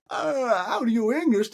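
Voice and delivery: high, groaning drunk mimicry; mock drunken American. Drunk American marvelling at the English drinkers, mocking high voice.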